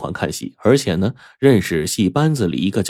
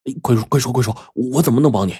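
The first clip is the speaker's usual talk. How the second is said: eager; 4 semitones higher, faster than usual. A narrator's reading. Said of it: "眼睛哗啦一下就亮了".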